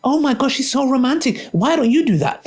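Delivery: high, gushing wife-viewer voice; mock gushing viewer. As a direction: swoon at a romantic gesture, hammed-up. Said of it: "you, sister, might watch and be like".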